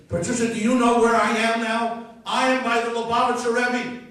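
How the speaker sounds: loud, high-pitched excited outburst; excited proclamation at full volume. Retold or imitated. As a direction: burst out with big news, high and very loud. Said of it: "To which I excitedly say".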